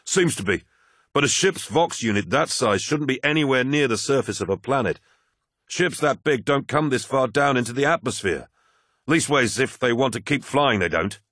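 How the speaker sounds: flight officer voice. Acted. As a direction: voice a tense, hurried officer.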